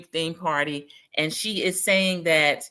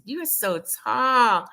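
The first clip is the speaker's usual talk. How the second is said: drawn-out, higher-pitched mock gush; imitated gushing; 5 semitones higher, slower than usual. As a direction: stretch it out, imitating her gush. Repeats the acted gushing greeting.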